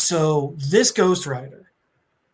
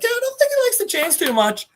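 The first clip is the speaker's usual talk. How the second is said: much higher and quick; cheeky Ghost Rider; faster than usual. Retold or imitated. Ghost Rider mocking Thanos to his boss, high and quick. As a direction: toss it off smugly. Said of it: "C G R is just like".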